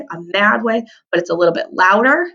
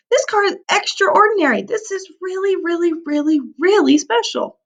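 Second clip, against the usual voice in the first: much higher, thrilled voice; excited gush; 10 semitones higher than usual. Acted. Teacher to kids about the amazing car, high and thrilled. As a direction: gush over the special car.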